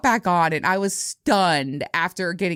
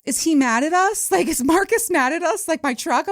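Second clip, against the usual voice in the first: put-on high little-kid worried question; daughter's voice; 10 semitones higher than usual. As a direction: imitate your daughter, pitched way up. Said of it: "my daughter's like".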